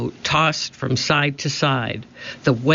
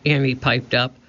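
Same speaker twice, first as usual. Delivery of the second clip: ordinary delivery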